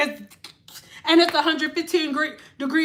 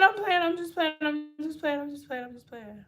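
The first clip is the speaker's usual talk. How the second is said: from raised pitch down to near-silent mumble; fading backtrack; 8 dB quieter than usual. Pretend. Streamer taking back a joke on a viewer, fading away. Repeated backtrack dwindling to a murmur.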